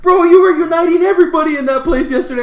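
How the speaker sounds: high, loud, laughing praise; excited retelling. Retold or imitated. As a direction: gush about last night. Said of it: "He goes".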